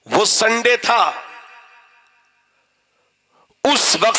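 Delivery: declaimed sermon in short phrases with long pauses; ringing, measured pulpit delivery; preaching. Acted. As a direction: preach it out line by line, holding the pitch. Preaches in declaimed, sustained high tone.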